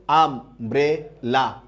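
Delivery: আম, ব্রে, লা slowly, one at a time; separate syllables. Shown as a demonstration. Teacher counting syllables for students, আম, ব্রে, লা said slowly apart. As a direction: leave gaps between আম, ব্রে and লা. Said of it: "একটা হচ্ছে আম".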